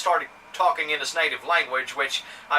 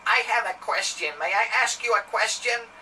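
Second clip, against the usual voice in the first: higher, put-on frail old lady; 6 semitones higher than usual. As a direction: play a sweet elderly lady asking politely. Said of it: "in my old lady voice".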